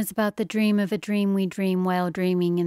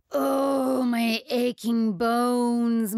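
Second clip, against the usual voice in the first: slow, higher crying moan; 5 semitones higher, much slower than usual. Acted. Hero moaning from his grave, slow crying moan. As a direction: drag it out like a groaning old ghost. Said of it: "moaned the Backstreet hero".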